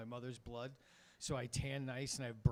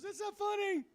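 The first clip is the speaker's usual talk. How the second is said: put-on much higher, louder whining child; crying kid; 6 dB louder than usual. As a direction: whine it through tears like a small boy. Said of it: "he cried again".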